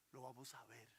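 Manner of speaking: normal talking voice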